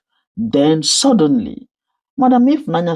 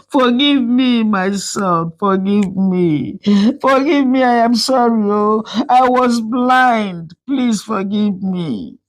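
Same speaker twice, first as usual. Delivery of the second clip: weeping plea; 6 semitones higher than usual. Acted. Mother begging her son for forgiveness, weeping plea. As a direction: sob out the apology. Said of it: "wept uncontrollably".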